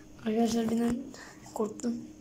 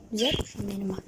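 soft unvoiced whisper to the bird; whispered soothing; 6 semitones lower, 7 dB quieter, faster than usual. Real, not acted. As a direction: hush the frightened bird gently.

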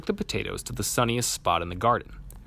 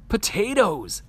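high, bright cry of discovery; excited shout; 10 semitones higher, 4 dB louder, slower than usual. A narrator's reading. Child finding potatoes in the garden shouts happily. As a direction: call out Potatoes! at the find. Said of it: "I shout".

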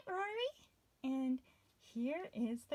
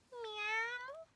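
cat meow; 6 semitones higher, 7 dB quieter than usual. A narrator's reading. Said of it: "The meowing cat".

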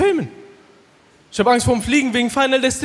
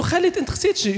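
lightly voiced shark; 10 semitones higher, 3 dB quieter, much faster than usual. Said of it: "Der so".

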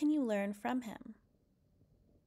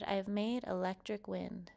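usual speaking voice

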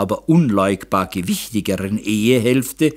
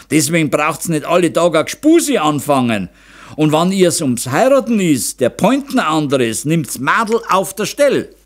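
mildly angry, raised-pitch objection; grumbling; 6 semitones higher, 3 dB louder than usual. A narrator's reading. Father disputes his wife about courting, grumbling. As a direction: argue back with a touch of anger. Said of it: "grollte Meister Zauner".

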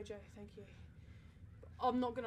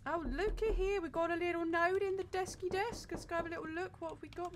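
higher, sing-song cute talk; mock cute; 7 semitones higher, 4 dB louder than usual. Real, not acted. Player coos over a note in the desk, mock cute. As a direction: coo over the little note.

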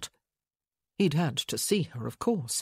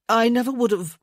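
insist, getting louder; rising protest; 6 semitones higher, 7 dB louder than usual. A narrator's reading.

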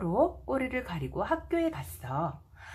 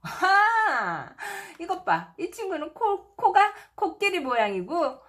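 delighted; 6 semitones higher than usual. A narrator's reading. Delighted pointing at pictures.

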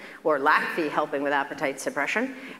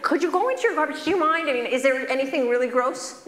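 much higher, fast eager plea; eager pleading; 7 semitones higher than usual. Retold or imitated. Doctor begs patient to search garbage, high fast plea. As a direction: push him with playful urgency. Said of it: "doctor pleads again".

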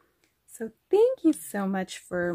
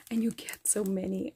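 soft unvoiced whisper of wonder; whispered awe; 2 semitones lower, 6 dB quieter, faster than usual. Real, not acted. Marvelling at the pile of gems, awed whisper. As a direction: hush it like a secret treasure.

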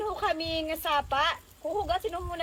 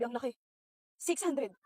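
ordinary talk, nothing marked

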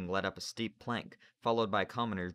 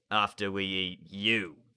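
menacing sailor; 5 dB louder, slower than usual. Acted. Narrator voices Slank.

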